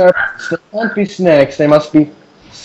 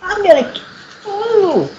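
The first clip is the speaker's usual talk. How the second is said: high squeal; 4 dB quieter, slower than usual. Real, not acted.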